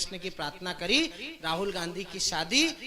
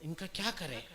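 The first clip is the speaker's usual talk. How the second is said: lower, much softer aside; thinking to oneself; 5 semitones lower, 10 dB quieter than usual. Acted. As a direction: voice the aide's worried private thought, low and soft. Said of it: "पीए ने सोचा".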